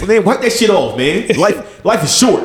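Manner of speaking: high-pitched nagging encouragement; mock pep talk. Coworker nagging him to cheer up, high-pitched.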